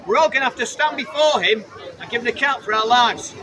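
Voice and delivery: usual speaking voice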